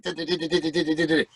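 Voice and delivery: fast chattering nonsense syllables, imitating a teammate; imitated nonstop jabber; rapid-fire gibberish. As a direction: rattle off a run of di-di-di like a motormouth. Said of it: "he used to talk like".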